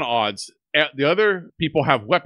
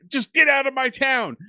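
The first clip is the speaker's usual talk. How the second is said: high, pointed mock shout; retold angry shout; imitated yell; 8 semitones higher than usual. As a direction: yell him out of town. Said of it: "He's, like, yelling and pointing".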